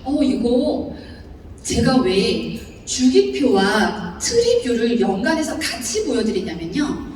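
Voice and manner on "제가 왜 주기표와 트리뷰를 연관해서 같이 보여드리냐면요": normal talking voice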